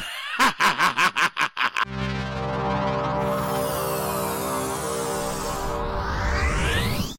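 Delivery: high, breathy maniacal cackle; evil villain laugh. Acted. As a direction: cackle like a mad god. Villain laugh after boast.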